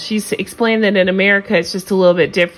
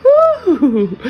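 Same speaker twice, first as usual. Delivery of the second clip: loud high whoop with a laugh; excited whoop; 10 semitones higher, 8 dB louder than usual. Real, not acted. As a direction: cheer it out, delighted. Cheering at catty quote.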